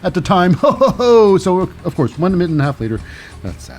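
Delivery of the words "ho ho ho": high, loud "ho ho ho"; mock laugh. Host reacting to a botched pin, loud high mock laugh. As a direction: let out a big raised mocking laugh.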